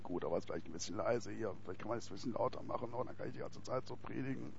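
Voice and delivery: very low, quiet bass rumble; extremely deep bass voice. Shown as a demonstration. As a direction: speak from the very bottom of the voice. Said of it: "Ich rede jetzt mit tiefer Stimme hier".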